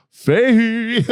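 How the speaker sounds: high loud shout of the name; platoon calling out. Retold or imitated. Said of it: "the whole fucking platoon was like".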